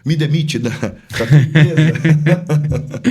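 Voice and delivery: loud, breaking into laughter; laughing. Real, not acted. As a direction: crack up loudly as the words come out.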